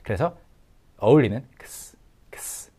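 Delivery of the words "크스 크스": "크스" as a puff and hiss, no vowels; voiceless KS ending. Shown as a demonstration. Teacher showing how K and S join, voiceless. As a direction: run "크스" together with no voice. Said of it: "무성음인 S로 발음해".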